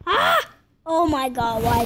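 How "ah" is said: short high squeal; startled shriek. Real, not acted. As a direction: flinch with a cry. Scare in game.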